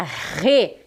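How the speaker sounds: sílaba "Re" com R aspirado forte; R bem forte. Shown as a demonstration. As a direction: diga "Re" com o R de garganta. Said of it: "bem forte". (erre bem forte em "Re")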